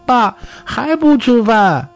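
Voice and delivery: put-on high-pitched small boy's voice; little boy. A narrator's reading. Boy nagging father about dinner, high child voice. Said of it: "男孩用拳头打了老李一下".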